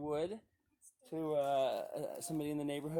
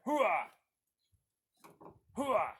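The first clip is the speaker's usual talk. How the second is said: loud gung-ho soldier; 4 semitones higher, 7 dB louder than usual. Acted. Dad cheering kids unloading wood.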